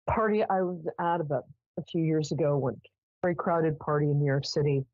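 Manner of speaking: ordinary talk, nothing marked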